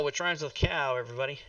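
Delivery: usual speaking voice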